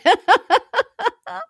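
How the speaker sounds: a real high-pitched burst of laughter. Real, not acted. Laughing that the client was so afraid, high squealing laugh.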